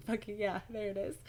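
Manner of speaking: ordinary delivery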